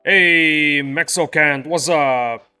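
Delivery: normal talking voice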